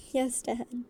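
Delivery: ordinary delivery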